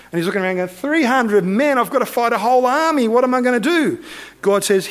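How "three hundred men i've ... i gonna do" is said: high-pitched incredulous dismay; mock-panicked Gideon, pitched up; acted panic. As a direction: protest the hopeless odds, voice raised in pitch.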